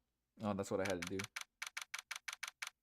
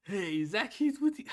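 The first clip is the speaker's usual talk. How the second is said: a hint of an old man, pitched higher; failed old voice; 9 semitones higher, 5 dB louder than usual. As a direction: strain for an old man and miss. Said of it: "I can't do an old voice".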